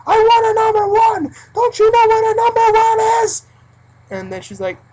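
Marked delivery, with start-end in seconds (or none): 0.1-3.5 loud, high flipping-out shout; old man flipping out and yelling; furious yell. Retold or imitated. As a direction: scream at the cashier in rage. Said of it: "the guy, like, flips out".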